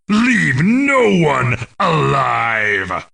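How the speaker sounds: slow, drawn-out menacing order; merciless command. Acted. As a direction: deliver a ruthless war order, stretching each word. Orders a merciless war attack.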